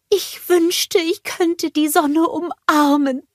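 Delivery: longing to hug the sun; dreamy Olaf. A narrator's reading.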